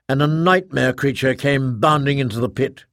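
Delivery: usual speaking voice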